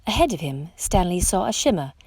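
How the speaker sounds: usual speaking voice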